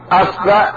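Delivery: أصبع said "asba'", a then a; fatha on both. Shown as a demonstration. Teacher showing fatha forms, أصبع as "asba'". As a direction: say أصبع with a on hamza and baa. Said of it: "ثم نأتي بفتح الهمزة".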